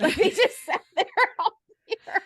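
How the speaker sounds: high squeaky laugh through words. Real, not acted. Laughing at own story.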